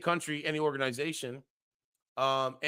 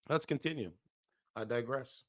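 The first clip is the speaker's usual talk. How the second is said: ordinary talk, nothing marked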